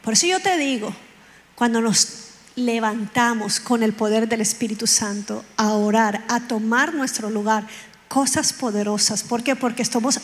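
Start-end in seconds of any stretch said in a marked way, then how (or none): none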